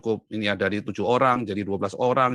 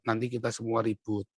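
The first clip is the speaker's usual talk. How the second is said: much softer, lightly voiced father; father's gentle caution; 2 semitones lower, 9 dB quieter than usual. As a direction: imitate a dad quietly warning. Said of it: "ayah saya selalu bilang apa".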